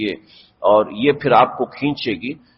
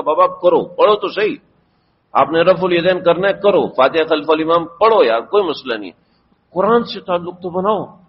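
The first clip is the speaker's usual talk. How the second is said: raised, higher-pitched retold remonstration; mildly exasperated, urging them on in a higher voice; exasperated challenge; 6 semitones higher, 4 dB louder than usual. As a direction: push back at them, pitched up and insistent. Retells his exasperated challenge to visitors.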